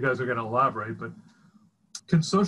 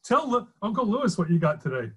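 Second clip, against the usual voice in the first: higher, bright proud-parent voice; beaming proud parent; 6 semitones higher than usual. Retold or imitated. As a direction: show off your kid to a relative. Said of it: "I sounded like a proud parent".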